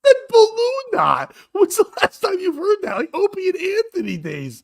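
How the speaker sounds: high-pitched, tickled disbelief; amused amazement. Real, not acted. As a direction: marvel at it, nearly cracking up. Delighted by the crude phrase.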